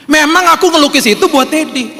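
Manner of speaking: put-on very high, eager kid voice; child's voice. Child explaining the painting was for Daddy, high eager voice. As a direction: speak up as an earnest little one.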